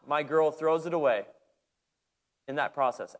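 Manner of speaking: ordinary talk, nothing marked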